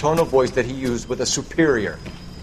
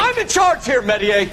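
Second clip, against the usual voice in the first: commanding shout; 12 semitones higher than usual. Acted. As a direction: pull rank on him, sharply. Asserts command over subordinate.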